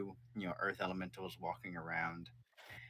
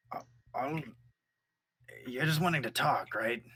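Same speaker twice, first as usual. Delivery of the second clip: higher, louder nervous creature voice; nervous elemental, pitched up; 6 semitones higher, 5 dB louder than usual. Acted. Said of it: "To the".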